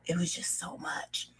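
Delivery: a hushed, spent whisper. Real, not acted. Admitting to viewers how heavy it was, whispered. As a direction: breathe it out almost silently. Recalling depression, voice drops.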